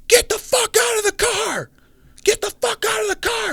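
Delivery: very high-pitched strained shout, volume held back; mock yell. Man with a pistol orders them out of the car, mock yelling. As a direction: imitate an armed stranger yelling orders. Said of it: "like, a yell, like, yelling".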